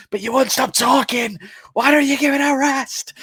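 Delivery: high, loud, mock-furious shout; exasperated outburst. Killer snapping at his talkative wife, hammed-up shouting. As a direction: erupt at her, voice shooting up.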